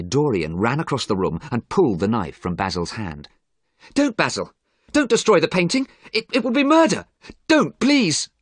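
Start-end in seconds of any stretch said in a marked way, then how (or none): 3.9-8.3 very high, louder desperate cry; frantic plea. A narrator's reading. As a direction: beg urgently, pitch leaping up. Dorian pleads to save painting.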